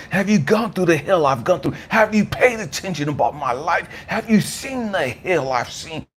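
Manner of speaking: indignant tirade, pitch climbing then dropping on the final question; angry retort. Retold or imitated. Hurt man answering God's question about his anger, furious and indignant. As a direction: hurl accusing questions back in outrage. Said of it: "And I'm like".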